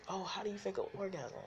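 imagined listener asks in a high voice; high mock question. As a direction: pitch it up as a curious listener. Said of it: "it's like".

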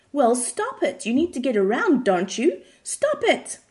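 loud, high, hammed-up scolding; mock outburst, jumping high at the end; exasperated yelling. A narrator's reading. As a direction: cut the patient off and build to a shout. Doctor loses patience, yells at patient.

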